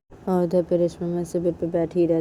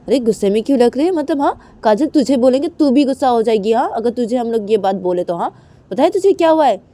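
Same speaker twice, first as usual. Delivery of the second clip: higher, louder fast retort; angry friend; 6 semitones higher, 9 dB louder than usual. Acted. Kajal's friend snaps that she'd be angry too, loud and fast. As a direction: fire back in anger.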